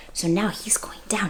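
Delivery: suspenseful hushed whisper. Acted. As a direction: whisper it like a secret. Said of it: "reader hushes for suspense".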